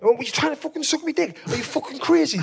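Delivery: high-pitched incredulous accusation; retold outrage. Telling mates how he confronted the driver, high incredulous outrage. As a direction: act out appalled disbelief, voice pitched right up. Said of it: "just proper angry".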